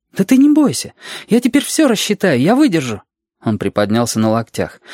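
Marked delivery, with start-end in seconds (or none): 0.1-3.0 eager Reshka, reassuring. Acted. Reshka reassuring Alexander about the journey, high child voice. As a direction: pitch it high, like an insistent child.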